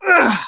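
short exhaled grunt; pitching grunt. Shown as a demonstration. Dad demonstrates exhaling on a pitch, short grunt. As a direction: grunt as you let the pitch go. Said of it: "kind of grunt, exhale when you pitch".

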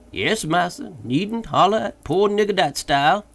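high dialect voice calling down; Jupiter's voice. A narrator's reading. Jupiter answering.

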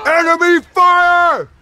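loud drawn-out yell; shouting. Real, not acted. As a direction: shout the warning slowly. Calling out fire.

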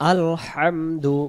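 আলহামদু chanted slowly with a closed "al" and breathed h; correct recitation. Shown as a demonstration. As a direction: draw out আলহামদু as "al-hamdu". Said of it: "এখানে উচ্চারণ হবে".

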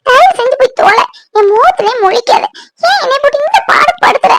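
high, tearful tirade; anguished anger. Acted. Woman blames him for her lost peace, anguished. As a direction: pour out distress in a high voice.

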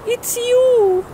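very high put-on voice; girlfriend in falsetto. Girlfriend recognizing her boyfriend, high-pitched exclamation. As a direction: lift it right up into falsetto.

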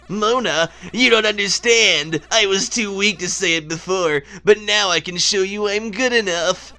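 lightly voiced, earnest cartoon villain; a touch of put-on dramatic confession; game character voice. Plague Knight explaining himself to Mona, mildly melodramatic. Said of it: "now I'm gonna do the voices".

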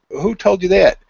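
mock incredulous. Retold researcher challenges the claim.

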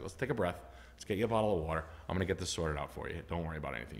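the salesman calming a crying customer, softly; soothing. Retold or imitated. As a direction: talk her down gently.

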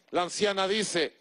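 usual speaking voice

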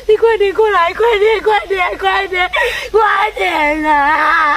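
wailing, desperate call for help; distraught pleading. Acted. Mother phoning her husband about the snatched child, distraught. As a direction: beg him to hurry, close to hysterics.

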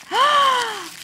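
gasp, then a high loud squeal; excited gasp and squeal. Real, not acted. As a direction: shriek with delighted surprise. Discovers matching washi tape.

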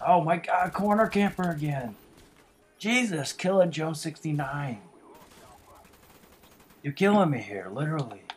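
high, loud furious rant; angry yelling. Real, not acted. Gamer rages at Killer Joe for camping corners, loud and angry. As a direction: bellow your outrage at the camper.

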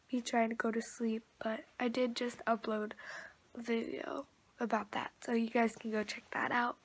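soft unvoiced whisper to the camera; hushed vlog whisper. Real, not acted. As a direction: whisper so no one wakes. Quiet morning vlog whisper.

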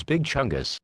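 ordinary delivery

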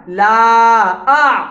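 slow stretched madd; long held vowel. Shown as a demonstration. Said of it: "क्या बन गया?".

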